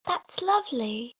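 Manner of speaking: soft, lilting character voice; gentle attempted impression; Clemmy. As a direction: say it softly, rising at the end. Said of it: "I can't get exactly it for Clemmy".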